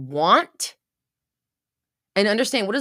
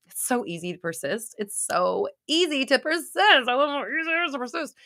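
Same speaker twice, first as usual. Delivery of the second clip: mock-cheery affirmation; 6 semitones higher than usual. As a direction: build to a high, exaggerated chirp. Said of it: "you're like".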